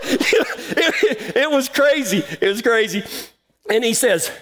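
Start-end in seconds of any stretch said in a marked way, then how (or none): 0.1-2.2 words broken up by real laughter; laughing. Real, not acted. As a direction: laugh through it, still amused. Laughing at the awkward memory.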